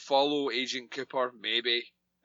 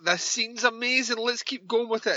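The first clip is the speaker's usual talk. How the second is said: excited; 9 semitones higher than usual. Retold or imitated. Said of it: "And I'm like".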